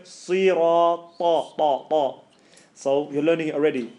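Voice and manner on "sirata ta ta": "sirata" drawn out with a heavy emphatic "ta". Shown as a demonstration. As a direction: stretch "sirata" and hit "ta" hard.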